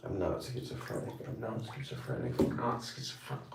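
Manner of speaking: breathless whispered denial. Retold or imitated. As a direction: whisper it over and over. Mocking repeated denial, whispered.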